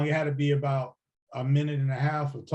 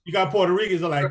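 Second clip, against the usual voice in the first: ordinary delivery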